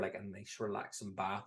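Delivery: normal talking voice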